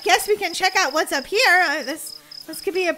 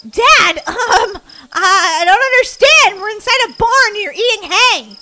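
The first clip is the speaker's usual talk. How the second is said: loud, raised, near-whining protest; distressed bewilderment; 6 semitones higher, 9 dB louder than usual. Acted. Kid finding Dad eating hay, dismayed. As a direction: protest loudly in confusion.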